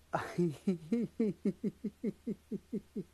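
soft, drawn-out run of real giggles; helpless giggling. Real, not acted. Speaker who can't stop laughing, helpless soft giggling. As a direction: giggle quietly and keep it going. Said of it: "I can't stop laughing".

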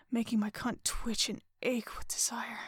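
plain reading voice

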